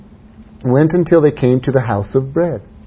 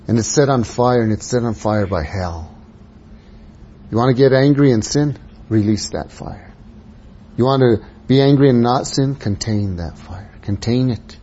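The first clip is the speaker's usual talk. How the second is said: ordinary talk, nothing marked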